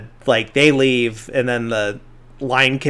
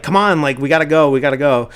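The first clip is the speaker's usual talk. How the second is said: raised, louder hurry-up, retold; mock-urgent kid pleading to get moving; impatient urging; 3 semitones higher, 5 dB louder, faster than usual. Ralphie hurrying everyone along to see Santa, mildly urgent.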